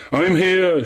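high, ringing call thrown back; echoing cry. A narrator's reading. Nymph echoing the lost young man, high cry. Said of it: "she cried".

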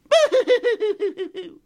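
high, loud boo-hoo cry noise; pixie sob. Acted. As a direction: wail tiny and high. Pixie sobbing.

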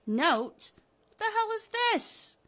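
high, loud surprised outburst; baffled and loud; startled discovery. Acted. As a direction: blurt it out, voice leaping up in disbelief. Wilma finds a note.

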